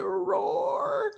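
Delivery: very high, imitated little-kid roar; child's lion roar. Son answering dad's lion question, high childlike roar. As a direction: squeak out a small boy's lion roar.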